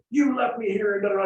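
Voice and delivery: Daniel-san's high, loud complaint; mock-angry teen protest; whiny yell. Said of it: "he's yelling at him".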